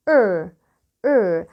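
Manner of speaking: Er repeated low as an isolated R sound; English R. Shown as a demonstration. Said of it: "حرف ال R في الإنجليش نطقه مختلف".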